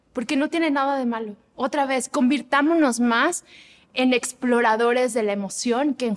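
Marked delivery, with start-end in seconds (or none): none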